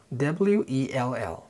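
W E L L spelled letter by letter; slow spelling. Shown as a demonstration. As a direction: spell "W E L L" out slowly.

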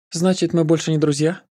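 put-on high, playful girl voice; teasing girl voice. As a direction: tease him, pitched high. Nika teasing her lover.

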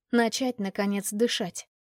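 ordinary delivery, nothing marked

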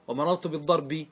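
بالضرب with ending -i, "bid-darbi"; final kasra. Shown as a demonstration. Teacher listing case endings, بالضرب as "bid-darbi". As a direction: voice the last vowel of بالضرب as i. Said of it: "لما تقف تنقل الحركات".

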